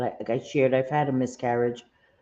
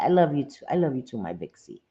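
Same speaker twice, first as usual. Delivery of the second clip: ordinary talk, nothing marked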